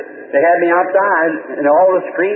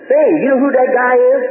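high-pitched, slightly raised call; calling over to someone; 8 semitones higher, 3 dB louder than usual. Retold or imitated. Brother spots the preacher in the crowd, calling over. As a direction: call out a nosy question.

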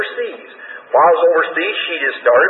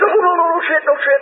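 much higher, frantic no; panicky refusal. Retold or imitated. Preacher imitating the nervous woman refusing the trip, high and panicky. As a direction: imitate a frightened woman crying no.

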